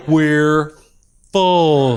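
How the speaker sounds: mock slow, drawn-out words with a pause between; patronizingly slow. Airline agent explains to passenger like he's in slow class, patronizingly slow. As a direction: stretch each word as if to a slow learner. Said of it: "talking to me like I'm in the slow learning class".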